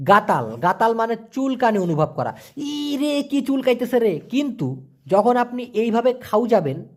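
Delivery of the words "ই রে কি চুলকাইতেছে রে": higher, whiny mock groan about an itch; mock itchy complaint. Teacher acting out scratching an itch for viewers, mock whiny. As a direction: play-act being itchy, a little comic.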